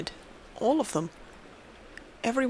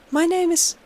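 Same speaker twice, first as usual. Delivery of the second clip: higher, louder, nervous answer; scared girl; 8 semitones higher, 4 dB louder than usual. A narrator's reading. Girl tells the creature her name, frightened. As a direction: blurt it out in fear.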